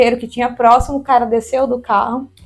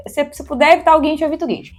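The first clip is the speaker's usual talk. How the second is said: normal talking voice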